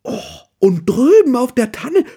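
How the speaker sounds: very high, loud, thrilled bear voice; excited call. A narrator's reading. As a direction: cry out in delight at the moon and stars. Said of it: "ruft sie begeistert".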